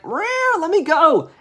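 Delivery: very high yowl into a loud plea; freaking-out cat. Acted. Cat yanked by its tail, high frantic yowl. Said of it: "the cat is freaking out".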